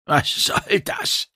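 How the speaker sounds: slow, strained, voiceless groan. A narrator's reading. Immobilised narrator to the captor, groaning. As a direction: groan it out with no air left. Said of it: "ächzte ich".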